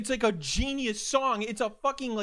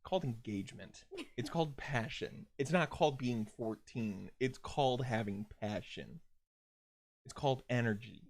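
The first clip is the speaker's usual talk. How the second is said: low, soft, deliberate correction; subdued defence; 7 semitones lower, 5 dB quieter than usual. Acted. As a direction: make the point quietly and low. Defending himself, drained after being teased.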